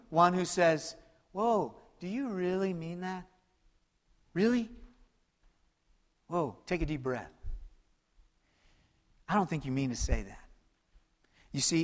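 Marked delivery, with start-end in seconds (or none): 1.3-10.4 gentle calming. Acted. Voicing calming friend.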